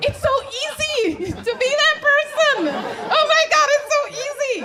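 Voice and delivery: high, loud mock wail; over-the-top exasperated yelling; hammed-up anguish. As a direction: cry out in comic despair. Acts anguish at becoming that person.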